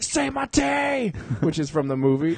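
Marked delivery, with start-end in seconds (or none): 0.0-1.1 yelling demand. Retold or imitated. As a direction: bark it at the guy in the arm bar. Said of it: "And I was like".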